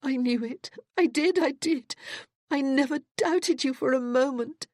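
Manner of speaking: high, loud, tearful and fervent; tearful insistence. Acted. Cassandra weeping, insisting she never doubted Judith. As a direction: insist through tears. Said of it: "Cassandra was weeping in earnest now".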